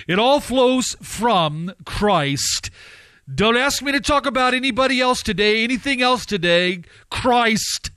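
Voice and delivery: fervent pulpit declaration; forceful preaching. Real, not acted. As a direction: preach it hard, landing on the name.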